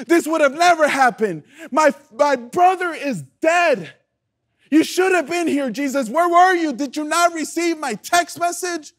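anguished, high-pitched reproach; crying out. Acted. Preacher acts a grieving sister confronting Jesus, crying out. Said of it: "cries out to him".

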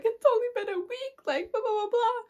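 high-pitched whiny protest; mock tearful pleading. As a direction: imitate a whiny, hurt plea. Acts out her tearful pleading.

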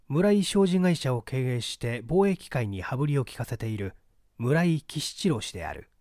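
ordinary delivery

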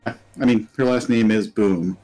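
usual speaking voice

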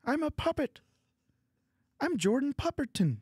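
high Canadian Kermit puppet voice; Jordan Pupperton. Acted. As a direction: introduce yourself as a Kermit-like puppet professor. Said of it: "he's like a Canadian Kermit".

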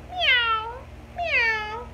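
high little kitten meows; small high mewing kitten. A narrator's reading. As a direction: mew like a tiny kitten. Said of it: "He could only go".

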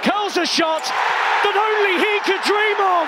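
rising, thrilled call on the goal; excited commentary call. Real, not acted. Commentator hailing Son's goal with excitement. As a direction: lift the voice high as the shot curls in.

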